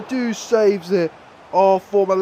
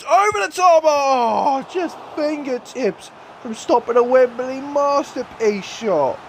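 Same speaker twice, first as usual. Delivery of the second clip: excited shout; 7 semitones higher than usual. Real, not acted. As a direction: shout as the shot almost goes in. Near goal in the final.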